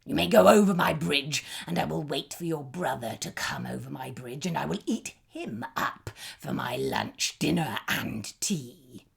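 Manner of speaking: troll planning to wait for the brother, gruff. A narrator's reading. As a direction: speak like a scheming troll. Troll lets the goat pass.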